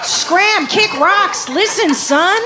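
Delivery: high-pitched mock dismissal of a kid; exasperated parent. Parent to six-year-old boy who keeps popping up, exasperated. Imagined parent shooing away child.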